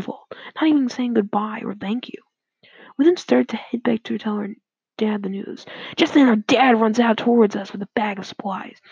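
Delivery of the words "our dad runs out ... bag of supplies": ordinary delivery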